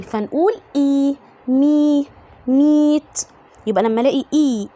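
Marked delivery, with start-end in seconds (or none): 0.8-3.3 "إي، مي، meet" sounded out with long ee; long E, built up. Shown as a demonstration. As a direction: build "meet" step by step: ee, mee, meet. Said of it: "لونج إي". (تهجي meet بصوت إي طويل)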